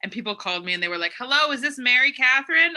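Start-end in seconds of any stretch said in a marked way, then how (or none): 1.2-2.7 polite caller, higher and louder; a caller politely asking for Mary Catherine; mock caller. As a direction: imitate a formal phone caller.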